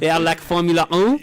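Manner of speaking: badly faked French, sliding into Russian; bad French accent. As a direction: put on a hammed-up French accent. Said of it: "I'll do a French accent".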